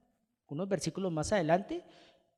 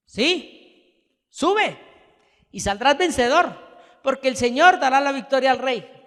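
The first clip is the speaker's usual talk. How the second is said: higher, louder mock blessing; sarcastic; 7 semitones higher, 9 dB louder than usual. Micaías answering King Acab with sarcastic encouragement. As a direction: promise victory while meaning the opposite. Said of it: "con sarcasmo".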